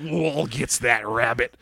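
lightly voiced old prospector, low and slow; slow, cartoon cowboy grumble; Yosemite Sam. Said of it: "He's Yosemite Sam now".

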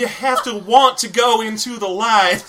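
high, slow, drawn-out movie voice; hammed-up spirit-medium line; film character parody. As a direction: coax them slowly in a put-on high voice.

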